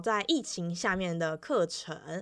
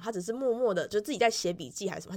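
ordinary talk, nothing marked

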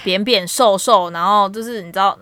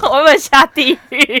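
laughing; 6 semitones higher, 9 dB louder than usual. Real, not acted. Joking guilt after lie.

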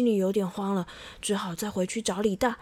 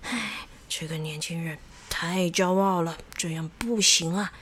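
lower, softer weary disapproval; disapproving sigh; 6 semitones lower, 4 dB quieter than usual. A narrator's reading. Villagers shake heads over the proud youngster, disapproving. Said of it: "都会摇摇头地说".

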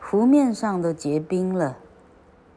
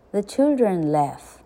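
ordinary delivery, nothing marked

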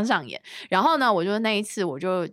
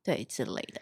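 whisper; 9 semitones lower, 10 dB quieter than usual. Real, not acted. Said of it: "whisper measured".

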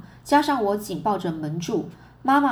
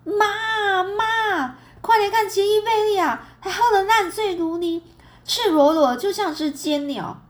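high-pitched yelling; 11 semitones higher, 3 dB louder than usual. A narrator's reading. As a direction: yell the news to the whole house. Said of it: "史无前例的高声叫喊着".

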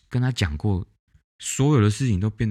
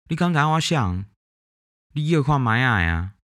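mimicking a rude caller; 7 semitones higher than usual. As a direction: play the pushy stranger who won't say who he is. Repeating the caller's garbled words.

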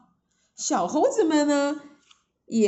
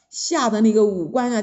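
ordinary delivery

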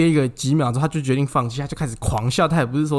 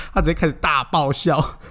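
ordinary talk, nothing marked

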